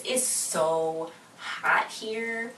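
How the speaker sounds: drawn-out complaint. Real, not acted. Complaining about Vegas heat.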